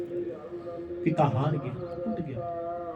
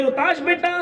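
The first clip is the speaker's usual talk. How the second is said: higher, more urgent call to the son; 9 semitones higher than usual. A narrator's reading. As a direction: call again, more desperate. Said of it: "दूसरी आवाज लगाई". (और ऊँची पुकार)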